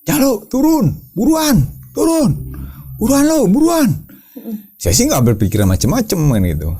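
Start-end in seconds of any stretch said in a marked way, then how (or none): 0.0-3.9 much higher, urgent shouted call; yelling to hurry. Retold or imitated. Friend at the bottom yells up to him, high urgent call. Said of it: "Dia teriak-teriak ke saya".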